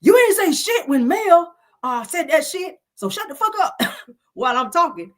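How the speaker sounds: high, loud retold outburst; snapping. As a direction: imitate an angry man snapping at his friend.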